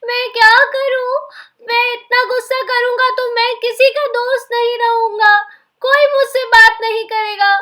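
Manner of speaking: tearful lament; mild crying, upset and sorry. Acted. Sonu crying to Peace, mildly tearful. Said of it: "Sonu जोर जोर से रोने लगा".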